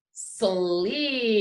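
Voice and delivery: slid stretched out slowly. Shown as a demonstration. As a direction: draw out slid sound by sound. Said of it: "I will say it slowly".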